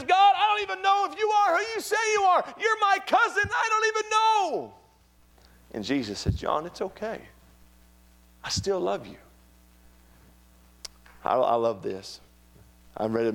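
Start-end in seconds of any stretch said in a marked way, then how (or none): 0.0-4.7 very high, loud cry of doubt; anguished doubting shout. Acted. John crying out his doubt to his cousin, anguished high shout. 6.4-9.2 gentle, higher-pitched comfort after the shouting; tender reassurance. Acted. Jesus answering John's doubt, tender and reassuring.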